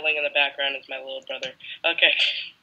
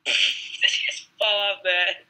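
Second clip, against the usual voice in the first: laughing; 5 semitones higher, slower than usual. Real, not acted. Laughs at teammate's fall.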